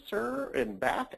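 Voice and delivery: ordinary talk, nothing marked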